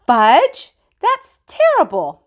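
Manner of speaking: high, loud shocked scold; scolding mother. A narrator's reading. Mother scolding Fudge for throwing the book, high and loud. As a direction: scold the toddler in dismay. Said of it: "my mother said".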